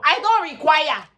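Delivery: loud, high, drawn-out objection; exasperated protest. Acted. Applicant answering the visa sponsorship question, exasperated protest. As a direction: cry it out slowly in mock exasperation.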